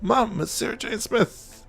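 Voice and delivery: high, hushed fangirl squeal; retold fangirl excitement. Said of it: "I lost my shit".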